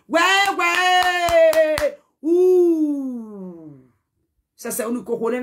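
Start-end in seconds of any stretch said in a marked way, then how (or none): 0.1-3.9 high, much louder cry of where, where; loud demanding call. Real, not acted. Demanding who spread the gossip, loud high call. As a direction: shout it out as if searching a crowd.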